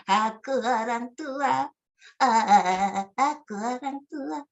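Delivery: put-on old person voice. Shown as a demonstration. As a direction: creak it out slowly like an elder. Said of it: "karakter suara".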